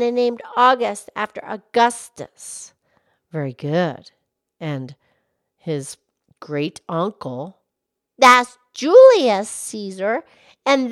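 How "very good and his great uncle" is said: much lower, gentle cow voice; Mildred the cow. Acted. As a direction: drop the pitch low to play the old cow. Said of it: "Mildred the Moo Moo Cow".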